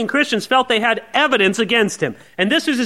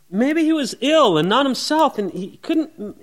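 ordinary talk, nothing marked

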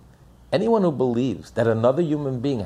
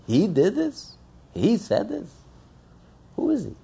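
higher, incredulous rising questions; mock indignant disbelief; 9 semitones higher than usual. Offended listener scoffing at the person who hurt him, incredulous. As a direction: play the offended man who can't believe the nerve.

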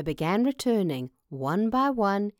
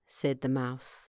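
ordinary delivery

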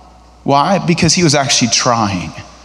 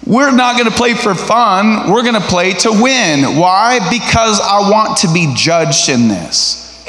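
competitive retort; 6 semitones higher than usual. Acted. Competitor answering someone who wants to play for fun, insistent.

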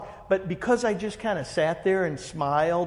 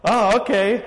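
higher, louder startled oh okay; taken aback; 5 semitones higher, 6 dB louder, slower than usual. Retold or imitated. BMW guy surprised by the speaker's reply, startled imitation. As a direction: caught off guard, recover. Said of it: "and he's like".

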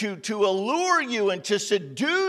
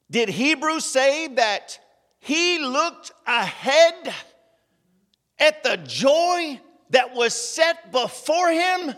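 higher, louder, slow preaching cry; slow, louder, higher sermon climax; preaching at full force; 5 semitones higher, 5 dB louder, slower than usual. Acted. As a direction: lift it and ring it out slowly. Preacher rising to emphasis.

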